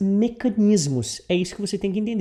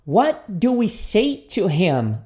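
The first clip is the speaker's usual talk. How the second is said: very slow, spaced question; repeat-after-me model; much slower than usual. Shown as a demonstration. Teacher introduces the question to students, very slowly. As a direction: lay out each word separately. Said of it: "Repita comigo".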